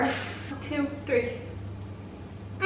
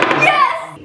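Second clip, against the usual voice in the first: triumphant yell of victory; excited shout; 13 semitones higher, 4 dB louder than usual. Real, not acted. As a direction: shout it like you just won. Wins rock paper scissors.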